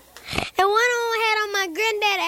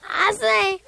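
plain reading voice

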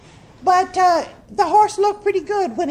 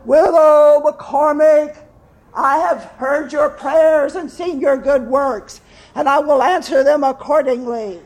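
booming voice; 2 semitones lower, 4 dB louder than usual. Acted. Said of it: "a booming voice says".

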